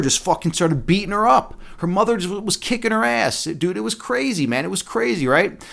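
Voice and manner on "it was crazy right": ordinary talk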